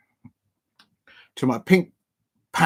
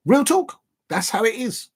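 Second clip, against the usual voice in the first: excited catchphrase; 8 semitones higher, 3 dB louder than usual. Real, not acted.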